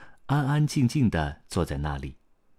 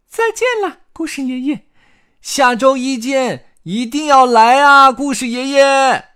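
very high, loud chick shout; calling out; 12 dB louder than usual. A narrator's reading. Carmelito calls goodbye to Grandpa Storyteller, shouting shrilly. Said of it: "卡梅利多喊道".

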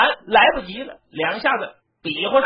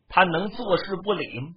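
ordinary delivery

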